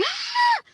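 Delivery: short high-pitched mock scream; quick, loud imitation of neighbours screaming; imitated scream. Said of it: "they're screaming, like".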